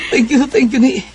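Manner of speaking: high, excited thank you; overjoyed thanks. Acted. He celebrates being accepted. (malipayon kaayo nga pagpasalamat)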